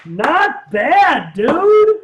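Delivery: loud, rising praise; excited praise. Real, not acted. Co-host praises the bubbles, loud and high. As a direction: cheer him on. Cheering the visuals.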